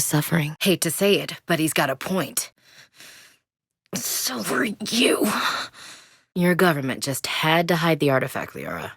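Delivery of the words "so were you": slow breathy whisper, barely voiced. Tender whisper to the commander, drawn out. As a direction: let it out slowly under your breath.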